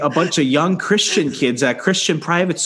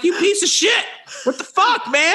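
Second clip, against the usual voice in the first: very high, shrieking mock insult; mock outraged shout. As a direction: shriek it at him in fake rage. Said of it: "And we're like".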